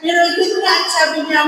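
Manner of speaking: ordinary talk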